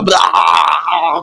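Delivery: gargled zombie scream; water held in mouth. Retold or imitated. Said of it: "vous faites ce cri-là avec de l'eau dans la bouche". (cri gargouillé, de l'eau dans la bouche)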